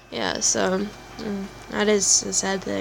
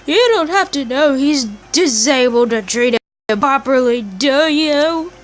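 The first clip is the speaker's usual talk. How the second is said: higher, louder furious scolding; angry confrontation; 4 semitones higher, 5 dB louder than usual. Acted. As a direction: tear into him for blaming others. Character confronts the accused.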